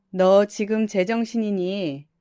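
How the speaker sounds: usual speaking voice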